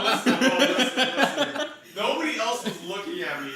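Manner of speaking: high, loud laughing protest. Real, not acted. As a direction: loudly plead your case. Defending himself amid laughter.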